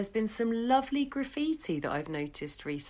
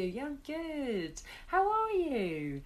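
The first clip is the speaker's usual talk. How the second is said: mock polite small talk; 7 semitones higher, slower than usual. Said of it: "So you're like".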